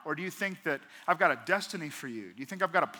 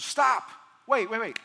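urgent angel voice; 3 semitones higher than usual. Retold or imitated. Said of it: "And he goes".

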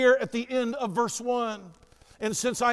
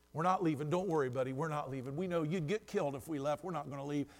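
low, soft, calming the jailer; quiet reassurance; 7 semitones lower, 9 dB quieter, faster than usual. Acted. Prisoners telling jailer they are not leaving, quiet reassurance. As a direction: keep it hushed and steady, like settling someone down.

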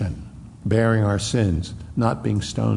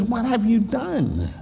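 high scornful taunt at a beaten prisoner; mocking Pilate; 10 semitones higher than usual. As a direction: sneer at him, much higher. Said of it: "He looks at him, he says".

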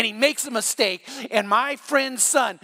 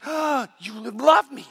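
slow, high, awed realization; wondering delight; 4 semitones higher, slower than usual. Retold or imitated. God answering the worshipper, slow and amazed. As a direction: let the wonder stretch out slowly. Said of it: "he looks and he's just".

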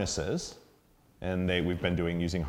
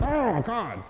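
high mock dog voice; puppy's exasperated groan. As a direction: voice the frustrated puppy. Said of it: "And they're like".

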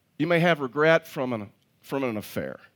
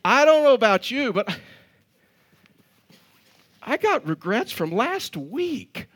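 emphatic preaching; 7 semitones higher, 3 dB louder than usual. Real, not acted. Preacher turns to congregation.

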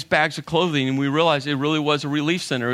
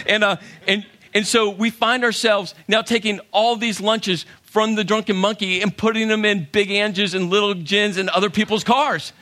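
ordinary talk, nothing marked

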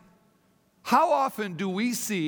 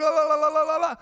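high, loud mock chatter of la la la; hammed-up babble of excited women; 9 semitones higher, 6 dB louder, much faster than usual. Pastor mimics the women talking excitedly, high and loud. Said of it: "they begin to talk".

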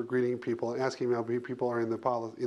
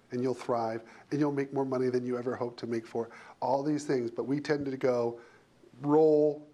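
ordinary talk

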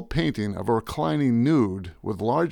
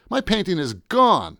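higher, slow, agitated lament; frantic dismay; 7 semitones higher, 3 dB louder than usual. Acted. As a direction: lament the loss, voice raised in pitch. Pacing frantically after the loss.

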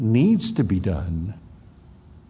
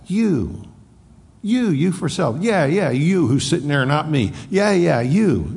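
higher and louder, punching every "you"; emphatic; 4 semitones higher, 4 dB louder than usual. Acted. Preacher voicing Jesus insisting to Peter, emphatic. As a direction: jab each "you" straight at the listener.

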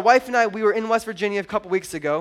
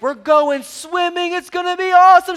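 loud, high, building pep talk; excited hype; 9 semitones higher, 6 dB louder than usual. Retold or imitated. Dad hyping daughter about the lake, loud excited build. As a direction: sell it to a kid, getting higher and louder.

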